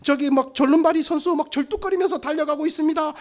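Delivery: high, running race commentary; sports commentator. Acted. Commentator calls patients racing to the pond, mock play-by-play. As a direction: call it like a live race, pitch held high. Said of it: "중계가 시작될 거예요".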